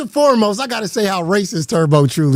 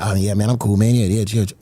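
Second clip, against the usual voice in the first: laid-back Tommy C; 12 semitones lower, faster than usual. Retold or imitated. Tommy C brushing off the ban, low lazy unbothered voice. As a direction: sound totally unbothered and lazy. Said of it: "I had Tommy C like".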